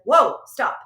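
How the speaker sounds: loud startled self-correction; sharp self-interrupting whoa. Retold or imitated. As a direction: stop yourself short, loud. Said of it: "And then I said".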